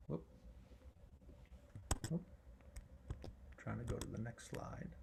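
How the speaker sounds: very low, very soft aside to self; barely audible talking to oneself; muttered aside. Real, not acted. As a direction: mutter under your breath while fiddling with slides. Talking to self while fixing slides.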